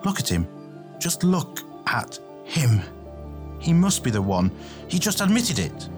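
building to high-pitched hostility; increasingly heated and accusing; growing hostile. A narrator's reading. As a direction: start controlled, end in a high, rushed accusation. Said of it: "His tone grew hostile".

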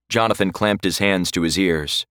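ordinary delivery

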